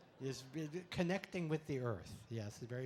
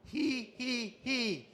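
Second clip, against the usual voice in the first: higher, loud he he he; leading the standing crowd in laughter yoga, high loud giggle; 6 semitones higher, 5 dB louder, much slower than usual. Shown as a demonstration. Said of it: "we're gonna start down here".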